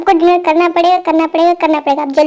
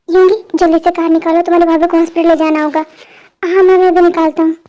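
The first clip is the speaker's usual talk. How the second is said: usual speaking voice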